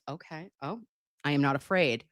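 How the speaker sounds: ordinary talk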